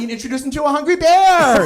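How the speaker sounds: punchline build. Real, not acted. Punchline delivered.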